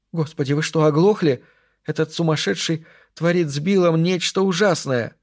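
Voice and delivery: high, distraught captive woman; acted panic in a high voice; panicked outburst. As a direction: plead in rising alarm, pitched high.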